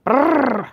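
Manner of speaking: lips buzzing brrr from the cold; shivery brrr. Real, not acted. Cold downstairs.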